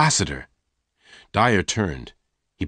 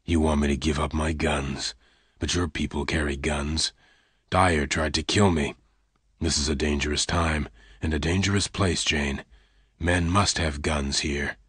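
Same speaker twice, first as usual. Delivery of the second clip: lower, softer, held back; low pained gunman; 4 semitones lower, 4 dB quieter than usual. Acted. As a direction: push her away quietly. Lassiter, hard.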